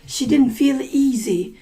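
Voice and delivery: usual speaking voice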